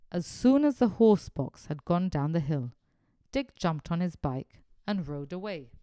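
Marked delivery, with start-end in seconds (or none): none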